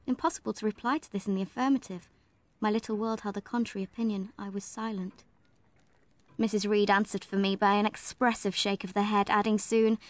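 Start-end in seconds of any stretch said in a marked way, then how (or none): none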